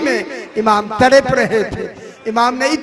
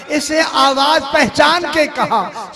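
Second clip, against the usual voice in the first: normal talking voice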